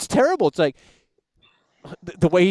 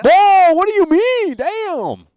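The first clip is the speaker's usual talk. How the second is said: high, drawn-out protest; indignant outcry; 12 semitones higher, 6 dB louder, much slower than usual. Real, not acted. Host protesting the caller's remark, indignant outcry. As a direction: cry out loud and slow, scandalized.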